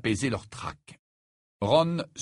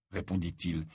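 usual speaking voice

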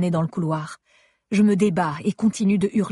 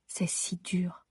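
slow, broken whisper; despairing whisper; 11 dB quieter, much slower than usual. A narrator's reading. Wife alone at her husband's hospital bed, in a slow whisper. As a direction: breathe it out, barely voiced, near tears.

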